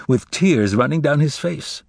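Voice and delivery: ordinary delivery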